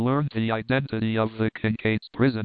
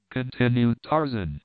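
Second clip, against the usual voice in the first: plain reading voice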